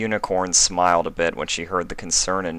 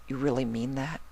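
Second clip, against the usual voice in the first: tearful; 8 semitones higher, 8 dB quieter than usual. Acted. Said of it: "wiping the tears from her eyes".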